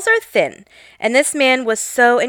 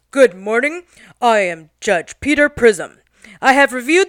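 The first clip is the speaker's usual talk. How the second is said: pretend TV judge. Mock courtroom judge voice.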